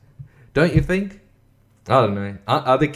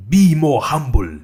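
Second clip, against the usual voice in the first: slow, loud mock declamation; put-on voice; 3 semitones higher, 6 dB louder, slower than usual. Mocking the author's tips, in a put-on voice. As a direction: announce it in a silly put-on voice. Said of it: "I'm just doing a voice".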